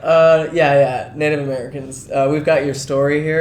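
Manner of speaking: hammed-up hollow reassurance to Native Americans; mock church voice. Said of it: "to be like".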